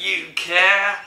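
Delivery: loud, high EastEnders yell; drawn-out mock Cockney cry; London accent. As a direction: holler like a Queen Vic regular in broad London. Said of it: "from the Queen Vic".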